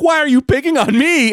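high-pitched mock effeminate protest; feminine whine. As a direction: imitate a wounded, effeminate complaint. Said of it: "he then went feminine".

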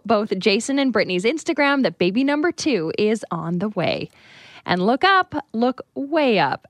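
upbeat radio news voice; radio news read. Acted. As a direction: read it like a morning show host. Reads morning news and weather.

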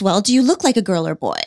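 ordinary talk

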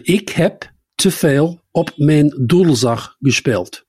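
slow, spaced-out Dutch sentence; slow, careful repeat. Shown as a demonstration. Repeating the Dutch for a puzzled co-host, slowly and carefully.

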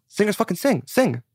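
insistent dad. Retold or imitated. Dad telling his kid singers sing, imitated insistence. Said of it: "That's all he would do".

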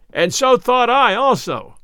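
high, loud, drawn-out cry; shouting with mirth. A narrator's reading. Robin bursting out of the thicket, shouting with mirth. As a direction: call it out slowly and merrily. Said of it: "shouting with laughter".

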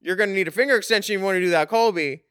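ordinary delivery

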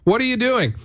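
high, sharp mock scolding. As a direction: scold the crowd, half-acted. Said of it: "kind of sounded off".

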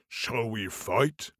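giant voice. Acted. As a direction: rumble it out like a giant. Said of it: "giant voice".

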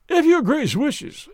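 high, slow, reluctant deference; stiff compliance. A narrator's reading. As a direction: yield stiffly, drawing the words out high. Dismissed secretary yields to his master.

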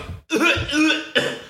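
high hacking fake cough; mock cough. Imitating the sick seatmate with a high hacking cough. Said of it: "Just was like".

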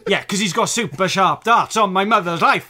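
loud, fast, laughing boast; school liar's tall tale. Acted. Liar boasting about his mother to classmates, loud. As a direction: pile on the lies, breathless and loud.

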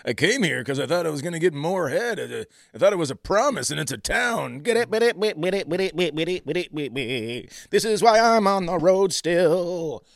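loud, high hack stand-up delivery; milk the corny punchline like a road dog; hack road comic. Acted. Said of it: "what all the road dogs' first joke is".

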